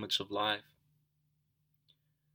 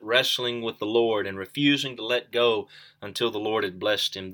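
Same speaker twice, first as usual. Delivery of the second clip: ordinary delivery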